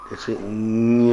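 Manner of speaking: ञ held long, nasal sound after the vowel; ञ stretched with nasal tail; nasal release. Shown as a demonstration. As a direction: let ञ end through the nose, slowly. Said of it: "नासिका का उच्चारण होता है".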